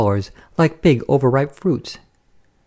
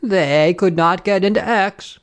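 high, slow gloating madame; triumphant; 6 semitones higher, slower than usual. A narrator's reading. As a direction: relish the victory, drawing it out. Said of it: "gave a little laugh of triumph".

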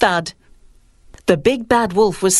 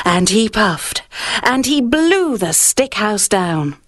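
plain reading voice

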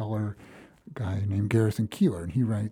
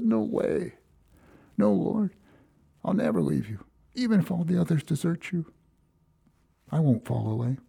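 a lightly voiced, higher Peter vowing loyalty; a hint of a young disciple protesting, pitched higher; Peter's eager vow; 6 semitones higher than usual. Peter insisting to Jesus he will never leave, lightly voiced higher.

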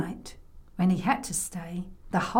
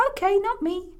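put-on high little child voice; brave little boy; 11 semitones higher than usual. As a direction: answer as a small boy being brave. Boy answers grandma.

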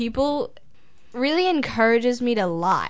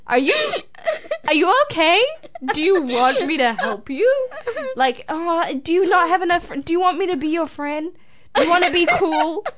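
higher-pitched questions through giggles; exaggerated, pitched-up offer of help; mock concern; 6 semitones higher than usual. Offering the pestering classmate help and friendship, mock-concerned.

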